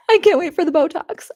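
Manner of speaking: laughing high through the words; bursting into laughter mid-line. Real, not acted. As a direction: giggle it out. Laughing at her own joke.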